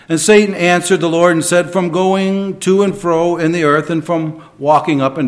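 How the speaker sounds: scripture reading. Acted.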